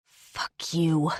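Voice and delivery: ordinary delivery, nothing marked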